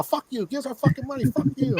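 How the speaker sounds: very high-pitched mobster tirade; Joe Pesci. Retold or imitated. Debt collector cursing tenant, high mock mobster tirade. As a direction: imitate an irate mobster, voice pushed way up. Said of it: "who's this fucking Joe Pesci?".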